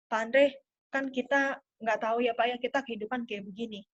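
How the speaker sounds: soft, low, reassuring pitch to a client; hushed, confidential sales appeal. Shown as a demonstration. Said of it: "intonasi yang lebih rendah, kemudian lebih pelan".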